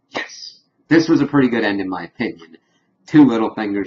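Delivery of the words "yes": breathy whispered cheer of delight; hushed gleeful whisper; whispered yes. Real, not acted. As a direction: whisper your satisfaction under your breath.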